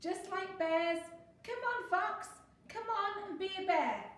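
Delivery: high, loud, stretched playful calls; gleeful shouting. A narrator's reading. Noisy friends calling Fox to climb, high shout. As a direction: urge a friend to join the game. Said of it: "they shouted".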